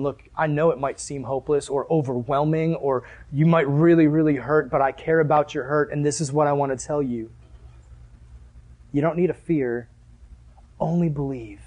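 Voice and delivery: low, soft, gentle assurance; reassuring Jesus. Acted. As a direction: speak tenderly, low and quiet, to someone hurting. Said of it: "Jesus is looking at you going".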